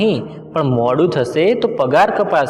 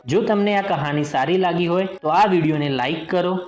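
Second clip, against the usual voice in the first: outro talk; 3 semitones higher, 4 dB quieter than usual. Real, not acted. The narrator asks viewers to like and subscribe, in a chatty sign-off. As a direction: wrap up and ask for likes. Channel outro after story.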